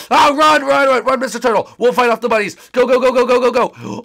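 high, loud rapid-fire urging; urgent shouting. Acted. Protector sending Mister Turtle running, urgent shouts. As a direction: yell at someone to flee.

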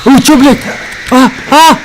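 sudden high, loud startled cry; startled yell. Real, not acted. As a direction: jolt out a frightened yelp. Startled by jump scare in game.

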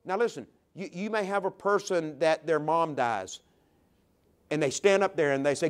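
ordinary talk, nothing marked

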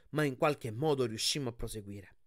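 ordinary delivery

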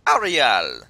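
loud, falling Jamaican-accented call. Shown as a demonstration. Player showing off the Sebastian impression, loud Jamaican accent. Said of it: "how I can do my Jamaican accent".

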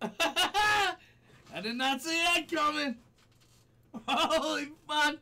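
high, loud, laughing shock; real rattled post-scare outburst; shocked disbelief. Real, not acted. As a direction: blurt it out high after a big fright.

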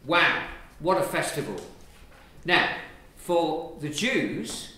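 ordinary talk

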